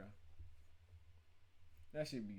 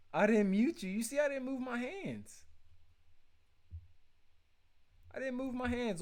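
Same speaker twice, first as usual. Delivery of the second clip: defensive loud; 8 semitones higher, 4 dB louder than usual. Real, not acted. Denying muting, defensive.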